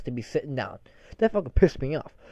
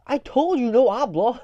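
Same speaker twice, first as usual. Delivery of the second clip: higher-pitched annoyed retort; mock exasperation; 7 semitones higher, 3 dB louder than usual. Worker answering a yelling co-worker, exasperated. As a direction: play an exasperated reply. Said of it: "she's yelling at me intermittently".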